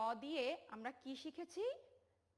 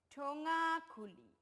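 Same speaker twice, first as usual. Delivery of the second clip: put-on slow, spaced ঠোঙা খুলি for children to repeat; teacher leading a choral read; 3 semitones higher, 4 dB louder, slower than usual. Shown as a demonstration. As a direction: lead the class slowly through ঠোঙা খুলি. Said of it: "আমরা একসাথে বলি সবাই".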